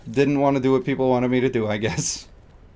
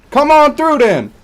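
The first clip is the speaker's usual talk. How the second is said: much higher, louder dare, going over the top; mock street tough, peaking; 12 semitones higher, 7 dB louder than usual. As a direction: push the challenge even harder. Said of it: "a little more urban".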